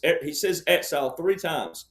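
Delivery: ordinary talk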